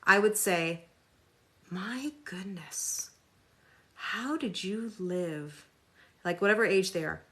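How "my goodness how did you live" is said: slow, soft sarcastic awe; sarcastic slow marvel at their neediness; mock amazement. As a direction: drag it out in fake wonder. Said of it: "This is what I say".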